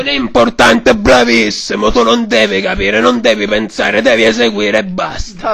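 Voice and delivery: loud hoarse mafia boss; Godfather voice. Acted. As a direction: bark orders like a don. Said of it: "signor Padrino".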